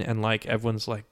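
ordinary delivery

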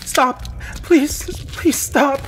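very high, loud shriek; panicked girl's breaking cry; desperate begging. A narrator's reading. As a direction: beg frantically, drawing out each plea. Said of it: "Melissa begged".

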